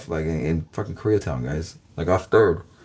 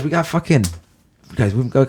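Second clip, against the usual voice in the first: normal talking voice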